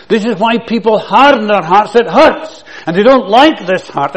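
high, loud preaching push; full-force preaching. Real, not acted. Preacher warning the church about hard hearts, loud and high. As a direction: hammer it home to the congregation.

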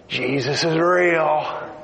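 slow and firm, every word weighted; intense declaration. Retold or imitated. Fred to the cashier, slow and intense. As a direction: lay down Jesus is real like a verdict. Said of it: "He looks and he goes".

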